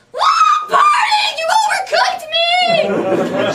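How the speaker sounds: very high, loud laughing shout; mock panicked scream. Patient pranks her radiation tech with a laughing shriek. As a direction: scream like you've been cooked alive.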